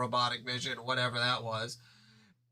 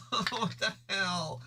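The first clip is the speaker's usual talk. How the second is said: laughing disbelief; 8 semitones higher, much slower than usual. Real, not acted. Bewildered laugh at own line.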